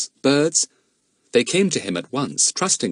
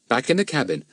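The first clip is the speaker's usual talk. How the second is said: usual speaking voice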